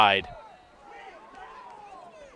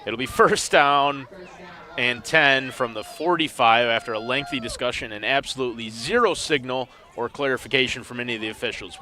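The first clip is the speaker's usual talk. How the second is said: normal talking voice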